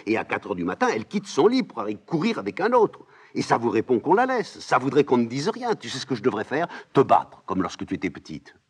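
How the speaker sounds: put-on old nurse loudly teasing and scolding; mocking scolding nurse. Nurse ranting.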